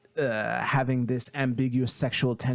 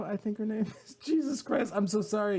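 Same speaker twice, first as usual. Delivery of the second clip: much higher, words breaking into laughter; laughing apology; 9 semitones higher, faster than usual. Real, not acted. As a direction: crack up at your own slip. Cracks up forgetting the name again.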